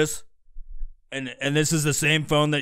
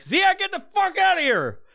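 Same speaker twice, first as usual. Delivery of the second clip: high-pitched acted yell; 4 dB louder than usual. Owner ordering the dog out, high mock yelling. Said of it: "fucking yell at your dog".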